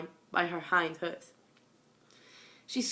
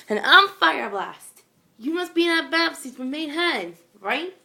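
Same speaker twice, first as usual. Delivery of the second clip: loud, higher, eager intro; bold loud pegasus; 8 semitones higher, 10 dB louder than usual. A narrator's reading. Pegasus introducing herself to Babsy, loud and bold. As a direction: boom out your name proudly. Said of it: "the orange Pegasus said".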